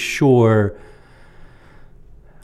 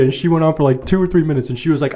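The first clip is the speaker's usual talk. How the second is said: normal talking voice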